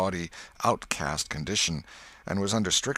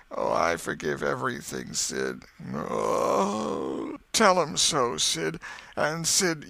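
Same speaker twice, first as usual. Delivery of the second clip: slow, hammed-up dying voice; mock deathbed farewell; 10 semitones higher than usual. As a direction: play the noble dying boy.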